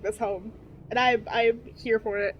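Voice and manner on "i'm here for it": normal talking voice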